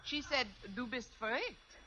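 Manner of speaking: gossiping comic German; fake German accent. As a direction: tattle in a put-on German accent. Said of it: "If I can do a decent German accent".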